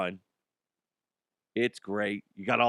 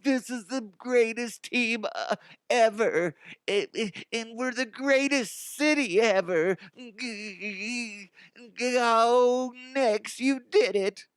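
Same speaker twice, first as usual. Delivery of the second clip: high, drawn-out mock blubbering; hammed-up overwhelmed fan, pitched way up; gushing fan parody; 11 semitones higher, slower than usual. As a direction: wail it as a mocking imitation of a devoted fan. Mocking Knicks fans at the parade.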